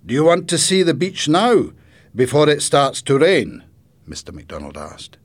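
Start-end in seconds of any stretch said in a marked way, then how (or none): 0.1-3.6 loud, lifted inviting question; grandfather character, inviting. Acted. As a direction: offer an outing as the storybook grandfather. Grandfather offers to show beach.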